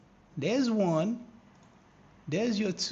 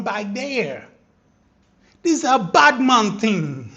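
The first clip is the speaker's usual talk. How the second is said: louder, hyped bragging; excited boasting; 2 semitones higher, 7 dB louder than usual. Real, not acted. Trader bragging his chart call, loud and hyped. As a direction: gloat about the call.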